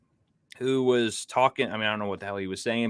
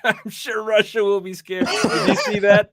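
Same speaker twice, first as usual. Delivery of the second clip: laughing; 8 semitones higher, 6 dB louder than usual. Real, not acted. Sarcastic laughing about Russia.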